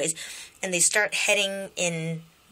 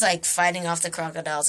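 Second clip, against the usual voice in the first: usual speaking voice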